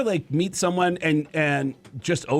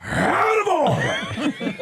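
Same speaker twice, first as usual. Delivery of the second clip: slow, soaring Pacino yell, much higher; Al Pacino shouting; 11 semitones higher, 3 dB louder, much slower than usual. Retold or imitated. [name] as Pacino hitting the big climax. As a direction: stretch it into a full Pacino shout. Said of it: "me as Al Pacino".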